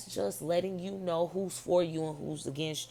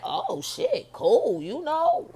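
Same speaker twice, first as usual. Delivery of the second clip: mock surprise; 11 semitones higher, 7 dB louder, much slower than usual. Retold woman's surprised reaction.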